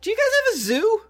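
very high, fast, excitable squeak; high-pitched goofy character; squeaky character voice. Acted. Plays character Ron, very high.

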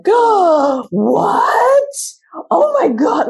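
high, loud horrified gasp of offense; shocked gasp. Retold or imitated. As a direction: act scandalized, high and loud. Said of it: "I would have like".

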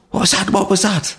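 high-pitched imitated Icelandic accent. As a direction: mimic a startled Icelandic woman's repeated question, pitched well up. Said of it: "in her own Icelandic accent".

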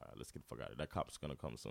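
low quiet murmur; hushed. Retold or imitated. Friends watching truck burn decide to flee, low hushed voice. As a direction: keep it low and furtive.

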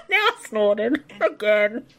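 ordinary delivery

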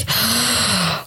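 a sudden frightened intake of breath; sharp gasp. Retold or imitated. Reliving the anaesthetic mask with a sharp gasp. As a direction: gasp as the gas mask goes on. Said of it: "what's that like?".

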